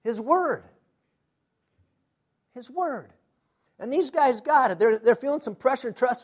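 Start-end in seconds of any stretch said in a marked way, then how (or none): 2.5-3.1 soft echo. Shown as a demonstration. Repeats phrase, second time hushed.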